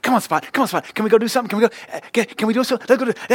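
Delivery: high, eager yappy puppy voice; squeaky pleading puppy nagging the bulldog; eager cartoon puppy. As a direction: beg like an excited little cartoon pup. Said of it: "and he's like".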